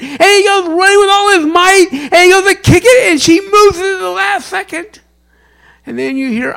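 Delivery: loud, high, fervent preaching; preaching at full force. Acted. Pastor telling congregation about Charlie Brown, full-force preaching. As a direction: preach it out to the back row.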